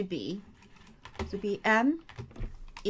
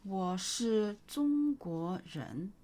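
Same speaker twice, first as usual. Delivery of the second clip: slow model answer; much slower than usual. Shown as a demonstration. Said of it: "teacher models answer slowly".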